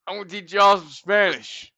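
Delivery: loud, cocky opener to the class; mock announcement. Boy announcing to the Spanish class, cocky and loud. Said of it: "And I was like".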